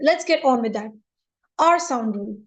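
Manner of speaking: ordinary delivery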